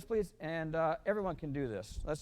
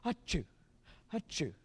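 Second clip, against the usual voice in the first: spoken "achoo" sneeze, light pickup into "choo"; mock sneeze; 3 semitones higher, slower than usual. Shown as a demonstration. Director likening a pickup note to sneezing, mock sneeze. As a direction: fake a sneeze, springing off the "a". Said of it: "almost like a sneeze".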